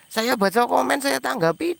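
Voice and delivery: high-pitched, put-upon complaint; exasperated protest. Real, not acted. Streamer listing what he does for viewers, exasperated protest. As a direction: plead your case in a high voice.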